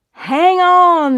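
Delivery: high loud shout, hang on; yelling. Acted. Brother yelling to his sister on the runaway pig, loud and high. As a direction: yell encouragement across the field. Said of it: "One yelling".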